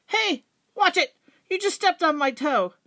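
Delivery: high, loud, cross yelp; annoyed animal shout. Acted. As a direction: snap at whoever stepped on you. Animals bump into each other.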